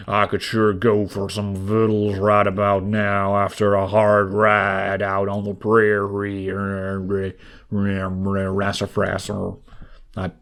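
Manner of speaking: put-on lightly drawled Western cowboy accent. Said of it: "I don't know how to sound like a cowboy".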